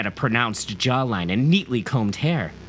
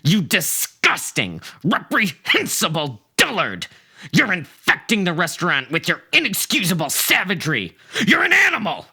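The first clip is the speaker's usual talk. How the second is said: building to a high, loud shout; outraged tirade peaking in a shout; furious rant; 8 semitones higher, 3 dB louder than usual. Acted. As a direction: tear into him with mounting outrage. Narrator rants at the man.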